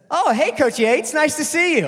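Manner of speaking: high, loud sugary hello; fake friendly greeting. Meeting old coach at Subway, forced cheerful hello. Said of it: "I said".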